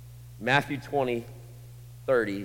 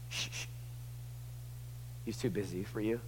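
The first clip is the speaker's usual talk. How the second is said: much softer dismissive hush; hushing crowd; 13 dB quieter than usual. Acted. Crowd silencing the blind men, hushed. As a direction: shush them down.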